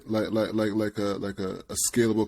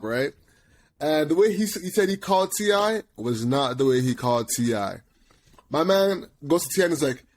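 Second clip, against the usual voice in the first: ordinary talk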